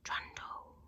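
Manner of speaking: soft, breathy whispered trundle. A narrator's reading. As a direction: breathe it so the sister stays asleep. Rolling in quietly.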